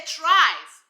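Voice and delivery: "tries" punched out loud on its own; stress on tries. Real, not acted. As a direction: hit "tries" hard, voice falling at the end. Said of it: "Keyword tries".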